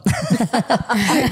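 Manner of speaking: high burst of laughter. Real, not acted. Host teasing guest about her papá, high laugh.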